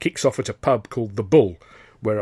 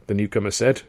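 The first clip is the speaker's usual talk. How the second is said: ordinary delivery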